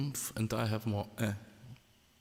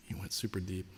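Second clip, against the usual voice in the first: whisper; 2 semitones lower, 6 dB quieter than usual. Real, not acted. Teasing the host after a deep remark.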